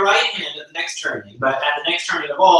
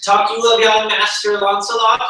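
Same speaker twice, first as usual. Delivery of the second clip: higher and louder, calling out; raised voice; 7 semitones higher, 5 dB louder than usual. Shown as a demonstration. Lancelot teasing his blind father, loud mock-grand question. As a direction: raise the waters, pitch "Talk you of young Master Lancelot?" up and loud. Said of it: "now I will raise the waters".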